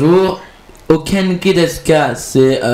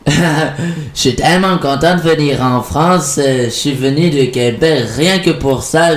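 Québécois accent. Shown as a demonstration. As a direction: play a visitor from Québec with the accent. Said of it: "je sais très bien faire l'accent québécois".

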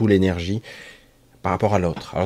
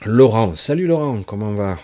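excited greeting; 4 semitones higher, 9 dB louder than usual. Real, not acted. Greeting a friend arriving in chat.

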